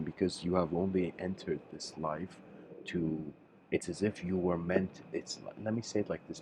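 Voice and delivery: normal talking voice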